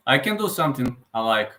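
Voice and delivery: ordinary talk, nothing marked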